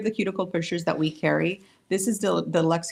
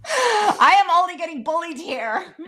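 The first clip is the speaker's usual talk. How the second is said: high, mock-wounded whine; joking complaint to the model, high and whiny; silly put-on voice; 11 semitones higher, 6 dB louder, slower than usual. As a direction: play the bullied victim in a squeaky voice. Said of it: "I don't know what the accent that was".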